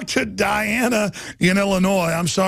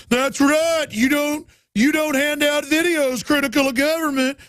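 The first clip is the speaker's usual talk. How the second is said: high, mocking voice of a smug judge; 8 semitones higher than usual. Host mocking the judge's quote to listeners, high and sneering. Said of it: "the judge was in the paper going".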